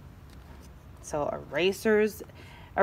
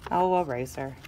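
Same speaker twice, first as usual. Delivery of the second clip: ordinary delivery, nothing marked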